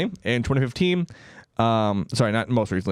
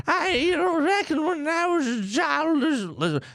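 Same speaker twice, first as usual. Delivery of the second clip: quoting a woman. Retold or imitated. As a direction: put on her voice, much higher. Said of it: "And she said".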